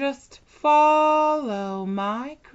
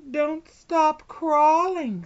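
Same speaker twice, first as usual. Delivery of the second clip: ordinary talk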